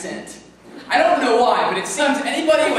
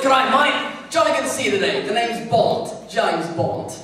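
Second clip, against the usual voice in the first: jolly put-on British accent; posh-accent spoof of a spy intro; mock British Bond. Bad accent skit for viewers, mock British. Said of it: "Good Accents Gone Bad".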